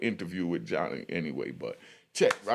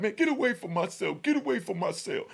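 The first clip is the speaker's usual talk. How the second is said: angry yell; 9 semitones higher than usual. Retold or imitated. Inmate yelling him away.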